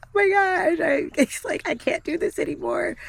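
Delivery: high, strained crying voice, breaking into a laugh; retold bawling. Patient breaking down on the phone, high tearful retold wail. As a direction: replay the breakdown, half-laughing. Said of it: "I'm bawling on the phone".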